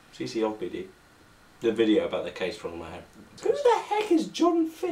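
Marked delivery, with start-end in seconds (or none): 3.4-4.9 much higher, louder squeak; cartoonish high-pitched question; squeaky outburst. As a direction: ask it in a silly squeak.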